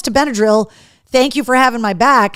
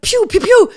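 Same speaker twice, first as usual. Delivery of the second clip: pew pew; 10 semitones higher than usual. Retold or imitated. Imitating missile shots.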